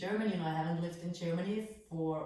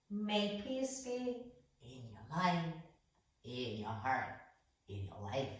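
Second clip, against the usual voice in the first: slow, soft blessing that sinks lower and quieter; closing blessing; 4 semitones lower, 4 dB quieter than usual. Acted. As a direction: bless the listener gently, letting each phrase drop. Closing blessing after yoga class.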